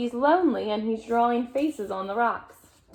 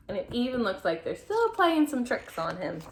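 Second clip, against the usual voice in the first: ordinary delivery, nothing marked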